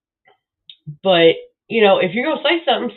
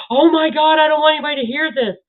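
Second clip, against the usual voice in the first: higher, hurried mock panic; mocking whiny uploader; 7 semitones higher, faster than usual. Mocking uploader who hides his video: hurried fake panic. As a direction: parody the timid uploader. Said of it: "then go".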